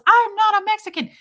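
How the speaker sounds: imitated housekeeper's high, loud outburst; indignant Lucy. Host imitating housekeeper correcting the girl, indignant shout. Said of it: "Lucy goes".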